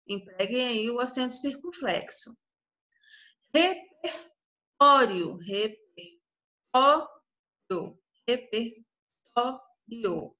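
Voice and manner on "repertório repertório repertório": "Repertório" very slowly, broken into syllables. Shown as a demonstration. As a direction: sound out "Repertório" with gaps, stretching each part. Teacher sounds out word by syllables.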